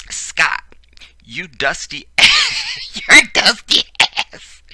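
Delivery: high, loud, drawn-out words through laughter; cracking up. Real, not acted. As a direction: read the insult while losing it laughing. Cracking up reading an insult aloud.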